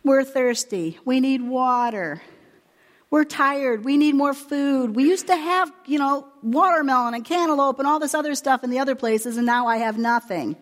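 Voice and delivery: mock the whining of a weary crowd; complaining. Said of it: "They started to complain".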